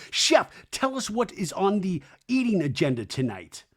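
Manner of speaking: slightly high grand mimic; mock pompous host. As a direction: declaim it with pomp. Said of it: "loves the pomp and circumstance".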